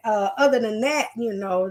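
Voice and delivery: usual speaking voice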